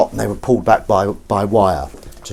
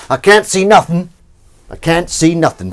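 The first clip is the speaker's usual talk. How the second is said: voicing the cat; 9 semitones higher, 7 dB louder than usual. Voicing cat looking outside.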